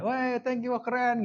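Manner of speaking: high-pitched gushing commenter; mock fan enthusiasm. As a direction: imitate an excited online follower. Mimics enthusiastic social media commenter. (menirukan komentar penggemar dengan nada tinggi antusias)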